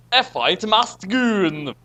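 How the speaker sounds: normal talking voice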